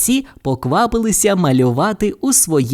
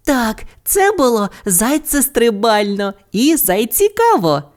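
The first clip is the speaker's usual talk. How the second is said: high, excited little character voice; high-pitched enthusiastic agreement; 9 semitones higher than usual. A narrator's reading. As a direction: agree gleefully, pitched well up. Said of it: "підтримала її Бу".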